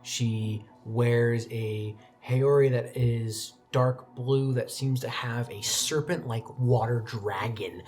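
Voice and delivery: usual speaking voice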